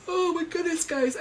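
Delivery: words stretched by a big yawn. Real, not acted. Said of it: "sorry to yawn on here".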